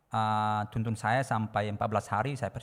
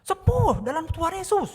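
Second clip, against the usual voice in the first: very high, loud, drawn-out prayer cry; high yelled declaration, slowed; re-enacted shout; 7 dB louder than usual. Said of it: "Saya langsung teriak gitu".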